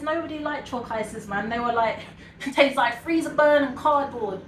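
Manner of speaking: ordinary talk, nothing marked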